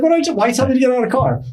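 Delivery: fast, high-pitched flustered protest; mock panic. Storyteller imagining himself questioning the officer, mock panic. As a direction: blurt it out high and rushed.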